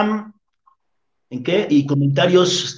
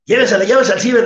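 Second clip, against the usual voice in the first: high, loud teasing push; mocking compadre; 7 semitones higher, 5 dB louder, much faster than usual. Compadre teasing the doctor to take her, loud mocking jeer. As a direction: egg him on with a jeer.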